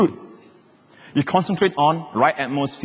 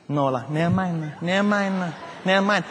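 false modesty refusal; 3 semitones higher, 4 dB quieter than usual. Acted. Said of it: "this idea of".

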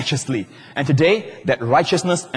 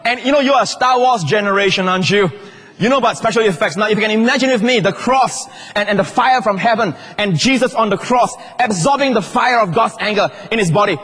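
high, loud, shouting sermon climax; preaching at full force; 8 semitones higher, 3 dB louder than usual. Acted. Preacher to congregation, loud impassioned high preaching. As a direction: thunder it out to the church like a revival preacher.

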